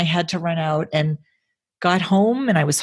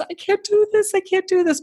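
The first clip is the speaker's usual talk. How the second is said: high, overwhelmed cry of defeat; mock panicked wail. Telling her husband she can't cope, high and overwhelmed. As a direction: pitch it up like you're at the end of your rope.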